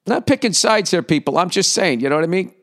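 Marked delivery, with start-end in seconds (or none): none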